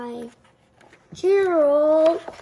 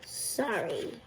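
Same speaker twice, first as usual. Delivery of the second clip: much softer and lower, sheepish; soft apology; 9 semitones lower, 13 dB quieter than usual. Acted. Gerald apologizing softly after being asked to be quieter. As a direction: barely breathe the word. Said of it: "you tried to sneeze more softly".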